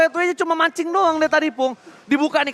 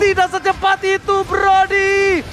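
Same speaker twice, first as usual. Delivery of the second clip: higher, louder hype call; fighting game announcer; 7 semitones higher, 4 dB louder, slower than usual. Acted. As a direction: call the teamfight big. Hyped play-by-play call.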